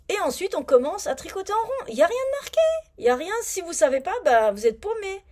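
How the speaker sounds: rising indignation at a badly written pattern; venting about the missing instructions, pitched high; exasperated rant. Real, not acted. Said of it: "Ça m'a énervé".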